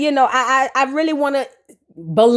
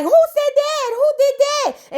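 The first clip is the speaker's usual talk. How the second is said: much higher, slowed, faux innocent; mock innocent high voice; 12 semitones higher, slower than usual. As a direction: squeak it like you never did it. Girls denying in high voices.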